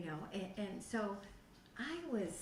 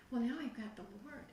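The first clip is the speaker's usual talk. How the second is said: ordinary talk, nothing marked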